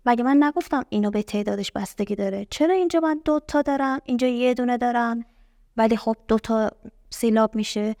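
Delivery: ordinary talk, nothing marked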